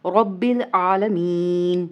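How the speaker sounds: आलमीन held long and closed on a still न; waqf ending. Shown as a demonstration. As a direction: recite slowly, stopping आलमीन on a vowelless n. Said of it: "ज़बर ख़त्म हो जाएगी तो क्या बन जाएगा". (आलमीन को खींचकर साकिन नून पर रोकें)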